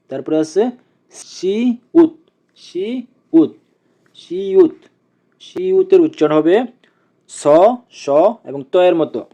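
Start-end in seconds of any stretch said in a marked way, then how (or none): 1.1-4.8 সিউত high, with a long drawn সি; pronunciation drill. Shown as a demonstration. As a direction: stretch সিউত out as a model for learners. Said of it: "আমরা আবার একসাথে উচ্চারণ করি". (সিউত উঁচু স্বরে টেনে উচ্চারণ)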